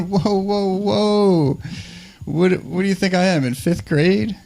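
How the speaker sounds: high, drawn-out protest of offence; mock indignation. Comedian to date who asked about smoking pot, mock indignation. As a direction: pitch it up as if insulted.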